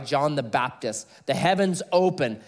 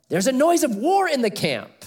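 alarmed; 9 semitones higher, 4 dB louder than usual. Acted. Joshua alarmed at camp noise.

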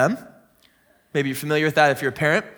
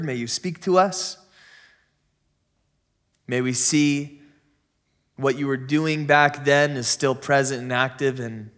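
low, softer public prayer; 5 semitones lower, 4 dB quieter than usual. Real, not acted. Pastor leading the church in prayer before the message, low prayerful voice. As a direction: pray sincerely in a low, even voice.

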